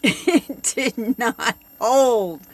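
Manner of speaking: slow and loud, with a laugh; slow, loud, laughing punchline; laughing emphasis. Real, not acted. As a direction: drag out the punchline through a laugh. Punchline of the tape story.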